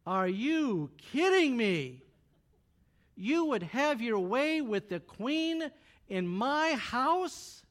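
loud, slow, outraged demand; furious king. Acted. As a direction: drag each word out in royal fury. King catches Haman on queen.

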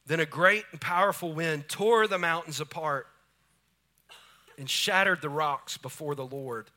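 loud, booming sermon delivery; preaching at full force. Acted. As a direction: thunder the wind, quake and fire. Preaching the Elijah passage.

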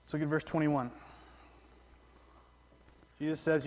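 usual speaking voice

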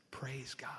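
hushed, breathless Praise God; breathy whisper. Retold or imitated. Orphanage director whispers thanks to God, breathless hush. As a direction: whisper it in awe, almost no voice. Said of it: "in a breathy voice".

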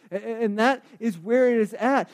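normal talking voice

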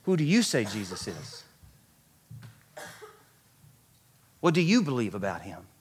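low, soft, searching question; hushed, earnest challenge; solemn appeal. Real, not acted. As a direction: put it quietly and personally to the listeners. Preacher puts question to congregation.

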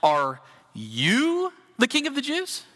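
slow, sneering question that looks down on him; condescending. Retold or imitated. Pilate looking down on Jesus, slow condescending sneer. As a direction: drag it out like you can't believe it. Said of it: "meant to be read in a condescending tone".

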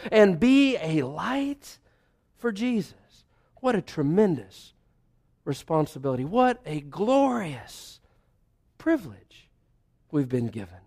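soft, intimate pulpit voice; hushed preaching. Acted. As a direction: pull the room in close, quietly. Sermon drops into hushed intimacy.

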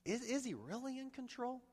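soft, high, hesitant question; whispered doubt. Acted. Preacher voices what the heart asks about God, hushed doubt. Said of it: "we're kinda whispering".

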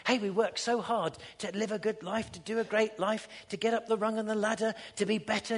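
ordinary delivery

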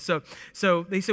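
normal talking voice

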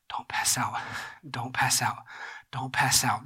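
low, hushed whisper to yourself; barely voiced pep talk to stay upright; whispered self-talk. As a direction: whisper it like a private mantra, pausing between repeats. Faint best man talking to himself.

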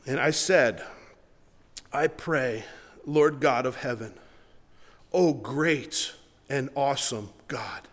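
slow, low, reverent reading of the prayer; scripture reading. Acted. Pastor reading Nehemiah's prayer aloud, slow and reverent. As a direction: read the prayer with awe, taking your time. Pastor reads verse five.

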